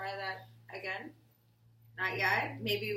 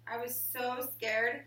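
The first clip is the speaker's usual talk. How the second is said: put-on reading to children. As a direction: read it to the class like a picture book. Reading picture book aloud.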